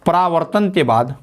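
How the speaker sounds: dictation. Shown as a demonstration. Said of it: "तो लिख लीजिए".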